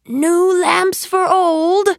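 high, long-drawn hawker's cry; street-seller's shout. A narrator's reading. Disguised magician hawking outside the palace, shouting. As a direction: call out your wares slowly down the street. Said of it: "he shouted".

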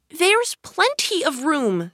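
put-on high, slow indignant girl voice. A narrator's reading. Alice objecting to the tea party, indignant. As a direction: protest indignantly, drawn out and high. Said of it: "said Alice indignantly".

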